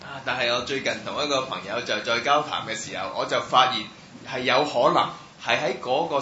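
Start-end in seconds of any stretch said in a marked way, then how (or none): none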